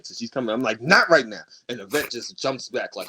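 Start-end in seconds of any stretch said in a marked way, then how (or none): 0.8-1.4 louder sharp brush-off; curt angry. Retold or imitated. Brushing off friend Yvette mid-fight, angry. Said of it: "she's never seen me mad".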